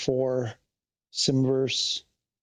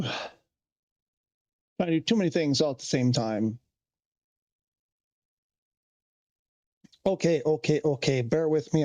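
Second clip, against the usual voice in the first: out of breath. Real, not acted. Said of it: "I'm still sort of catching my breath".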